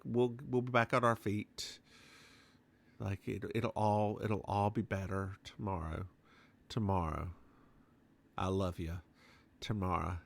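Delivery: soft, hushed reassurance; gently, like comforting someone you love; tender quote. Retold or imitated. Husband comforting secretary, tender quote.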